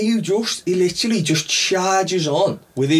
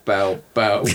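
pouty mock sound; 8 semitones lower than usual. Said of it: "He's doing the pout".

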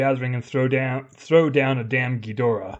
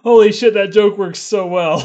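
loud, high delighted laughter; laughing loudly; 6 semitones higher, 7 dB louder than usual. Real, not acted. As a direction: roar with laughter at the joke. Cracking up at the joke.

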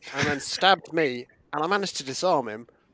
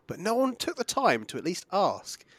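ordinary delivery

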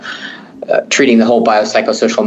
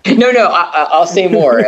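laughing; 8 semitones higher than usual. Real, not acted. Laughs at being teased.

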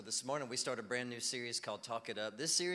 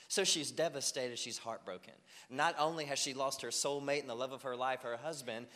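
ordinary talk

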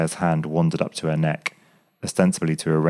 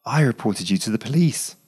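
higher, fierce confession; passionate, almost fierce; 5 semitones higher than usual. A narrator's reading. Claire, confessing to Graham, passionate and fierce. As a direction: own up to it fiercely. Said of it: "she answered passionately, almost fiercely".

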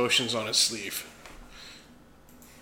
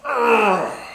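loud, high "ugh" falling away; hammed-up groan; 9 semitones higher, 10 dB louder than usual. Player acting out getting kicked, loud mock groan. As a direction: groan as if taking a blow. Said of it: "I'm just gonna go".

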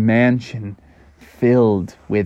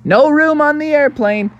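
high cartoon kid brush-off; little duck kids; 14 semitones higher than usual. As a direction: turn someone down in a squeaky kid voice. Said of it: "And like".

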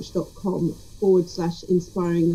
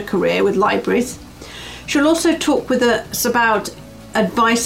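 upbeat host read of the episode preview, a touch louder; podcast intro read; 4 dB louder than usual. Acted. Host previewing the guest for listeners, lively promo read. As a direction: present the episode like a show trailer.

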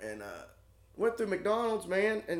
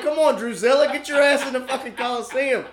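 high, loud teasing hurry-up; loud laughing nag; mock urging; 8 semitones higher, 8 dB louder than usual. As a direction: chivvy a friend like a stepsister.

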